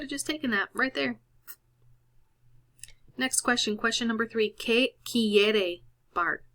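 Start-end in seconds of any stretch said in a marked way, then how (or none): 4.5-6.4 very slow reading. Shown as a demonstration. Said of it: "teacher reads question slowly".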